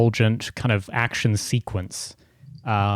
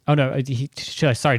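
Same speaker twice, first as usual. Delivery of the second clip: usual speaking voice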